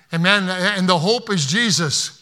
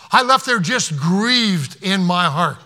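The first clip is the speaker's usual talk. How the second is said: ordinary talk, nothing marked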